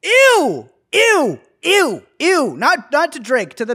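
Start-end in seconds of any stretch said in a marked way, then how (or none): 0.0-2.5 disgusted ew. Real, not acted. Repeated disgust.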